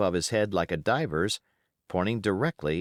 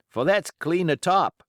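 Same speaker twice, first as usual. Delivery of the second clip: Morgan, higher; 9 semitones higher, 5 dB louder than usual. Acted. Pirate Morgan voiced in story.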